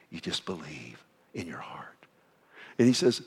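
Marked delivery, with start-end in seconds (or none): 0.1-2.1 whisper. Real, not acted. Preacher drops to intimate aside.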